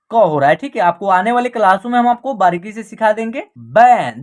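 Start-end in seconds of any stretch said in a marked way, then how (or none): 3.6-4.2 "बैन" much lower and louder, with an open "ऐ" vowel; model pronunciation. Shown as a demonstration. Teacher models "बैन" for students, low and loud. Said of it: "बन नहीं पढ़ना इसको".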